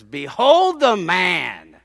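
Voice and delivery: loud, slow proclamation; proclaiming loudly. Retold or imitated. Pilate presents the beaten man to the crowd, slow and loud. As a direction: hold each word out and boom it. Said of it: "he says".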